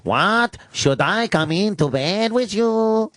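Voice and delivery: high, drawn-out mock Mexican maid accent; Spanish accent. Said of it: "Can you do a Spanish accent".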